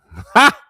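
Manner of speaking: loud, high single burst of laughter; short embarrassed laugh; sudden laugh. Real, not acted. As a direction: let out a sharp high cackle. Laughs about mama watching.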